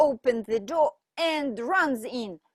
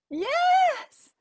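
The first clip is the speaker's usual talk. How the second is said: high squeak; 5 dB louder than usual. Acted.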